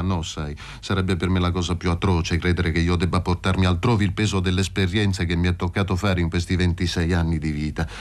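usual speaking voice

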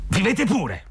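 stizza in tono molto acuto; sfogo irato del vecchio. A narrator's reading. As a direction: concedilo con rabbia, a voce alta di tono. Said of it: "riprese il vecchio". (vecchio irritato che sbotta)

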